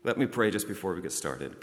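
ordinary talk, nothing marked

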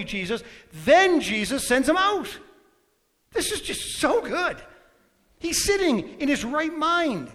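loud, rising preacher's fervour; full-force preaching. Acted. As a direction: preach it out, building the crowd.

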